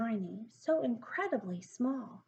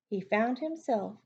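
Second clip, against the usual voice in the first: plain reading voice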